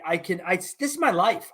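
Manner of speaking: ordinary delivery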